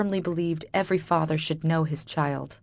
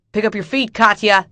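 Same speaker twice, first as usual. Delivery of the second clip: loud, higher bark; barking boss; 4 semitones higher, 7 dB louder than usual. Acted. Boss barking at the waitress to hurry, loud. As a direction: bark an order at her. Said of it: "my boss barks".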